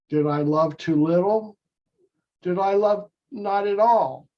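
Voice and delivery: normal talking voice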